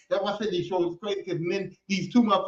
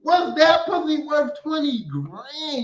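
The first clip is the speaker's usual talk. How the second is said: slow, stretched rhetorical question; slow drawn-out question; 7 semitones higher, 3 dB louder, much slower than usual. Retold or imitated. Asking if it was worth the money, slow and stretched. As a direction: drag each word out for effect.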